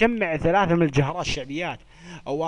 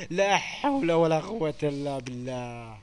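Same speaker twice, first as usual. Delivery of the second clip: slow dictation; 3 semitones lower, slower than usual. Shown as a demonstration. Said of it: "جملة كتابية اكتبوا".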